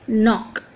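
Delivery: "नॉक" starting straight on N; silent K. Shown as a demonstration. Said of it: "इसका right pronunciation बनेगा".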